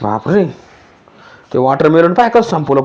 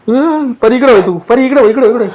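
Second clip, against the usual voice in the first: calling out; 7 semitones higher than usual. Pretend. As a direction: call the girl back, alarmed. Mother calls Pari away from ghost.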